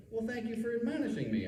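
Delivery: high, smiling, wry thanks. Retold or imitated. Teacher thanking the dean who scolded him, smiling. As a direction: say it with a sly smile. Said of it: "I say, smiling".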